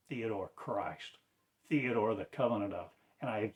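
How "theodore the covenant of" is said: ordinary talk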